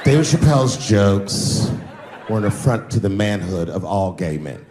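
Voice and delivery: mock a self-serious blogger. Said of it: "how he actually talks when he's making his voice up".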